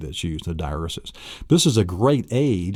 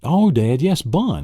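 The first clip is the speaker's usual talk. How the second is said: put-on higher, louder child discovering the answer; son's reply; 7 semitones higher, 4 dB louder than usual. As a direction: voice an eager son answering Dad. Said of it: "And he says".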